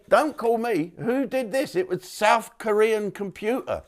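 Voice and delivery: high, indignant denial; mock indignant high voice; mock innocent protest. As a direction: play the wronged driver. Mock excuse to speed camera.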